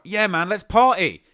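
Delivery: loud, high, drawn-out party cheer; stretched-out excited shout; party hype. Acted. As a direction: whoop it like the life of the party. Acted party-goer before sleepy contrast.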